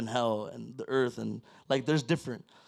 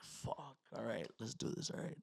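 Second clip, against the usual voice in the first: nervous self-talk; 3 semitones lower, 17 dB quieter than usual. Retold or imitated. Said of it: "And I'm like".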